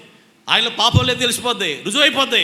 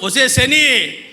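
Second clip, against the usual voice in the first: louder, scolding call at the wife; mock scolding yell; 2 semitones higher, 5 dB louder, much slower than usual. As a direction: throw the insult at her in play. Husband insulting wife in story.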